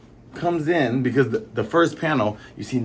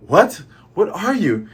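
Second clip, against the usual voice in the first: higher, louder, startled question; better dramatic read; 6 semitones higher, 4 dB louder than usual. Acted. Reader redoes the forest voice's line better, higher and startled. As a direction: put real astonishment into the question. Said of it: "let me read it better".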